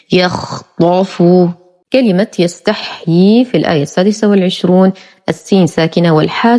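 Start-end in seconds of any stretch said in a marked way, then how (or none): 0.1-1.2 يخطف very slowly, open heavy "ta"; emphatic pronunciation. Shown as a demonstration. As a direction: draw out يخطف with a full emphatic ta. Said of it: "بفتح الطاء مع التفخيم". (يخطف ببطء بفتح الطاء مع التفخيم)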